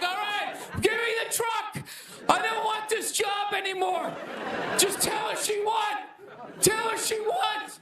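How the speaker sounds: high-pitched panicked mock wail; crying in panic. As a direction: plead like you're crying in a ball. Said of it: "crying in the fetal position".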